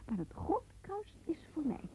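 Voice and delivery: whispered claim on the prize; possessive whisper. Acted. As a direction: claim it in a hush. Claiming the medal secretly.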